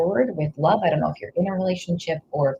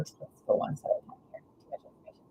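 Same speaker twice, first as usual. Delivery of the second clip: hushed, hurried whisper, barely voiced; whispered aside; 10 dB quieter, much faster than usual. Real, not acted. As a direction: drop to a fast, soft whisper, half to herself. Reader overwhelmed, reins herself in.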